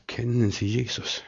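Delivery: very soft repeated question; calm, unexcited question. Retold or imitated. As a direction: ask quietly, unmoved by the outburst. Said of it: "gar nicht so aufgeregt".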